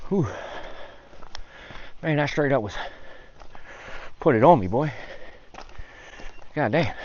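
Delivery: huffing and puffing on the climb; panting between words; out of breath. Real, not acted. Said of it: "Huffing and puffing already".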